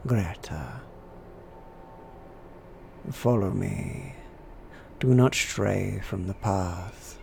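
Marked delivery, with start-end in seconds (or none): 3.1-7.2 slow, grave warning; solemn warning. Acted. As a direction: warn her slowly and gravely. Vicar warns visitor.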